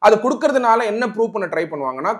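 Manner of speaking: normal talking voice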